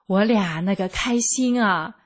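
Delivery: ordinary delivery, nothing marked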